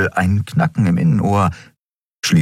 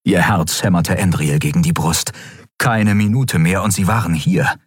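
ordinary delivery

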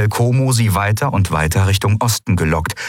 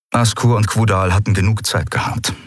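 ordinary delivery

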